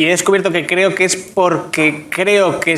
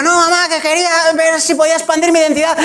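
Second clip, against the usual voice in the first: high pleading; 9 semitones higher than usual. Pretend. Son pleading with mother.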